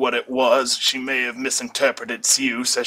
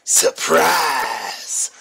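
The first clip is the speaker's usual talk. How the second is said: shouted surprise. A narrator's reading. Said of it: "someone shout".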